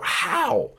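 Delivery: high, strained disbelief barely squeezed out; stunned, breathless how; choked how. Retold or imitated. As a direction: force the words out of a tight throat. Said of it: "all I could muster from my throat".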